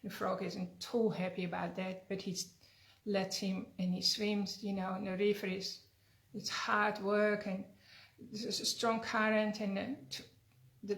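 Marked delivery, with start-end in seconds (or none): none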